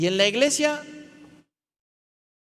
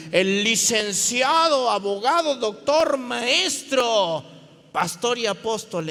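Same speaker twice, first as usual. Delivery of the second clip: loud titles building higher; pompous announcement; 3 semitones higher, 5 dB louder than usual. Acted. Preacher mocking the powerful arriving with an entourage, pompous. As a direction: herald a big shot with mounting grandeur.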